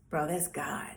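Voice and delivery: whispered, hushed in awe; awed whisper. Retold or imitated. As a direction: whisper it like a revelation. Friend awed, quoted in hush.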